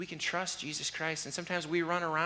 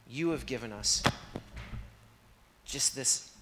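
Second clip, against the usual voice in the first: closing prayer. Acted. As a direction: pray it humbly, then build conviction.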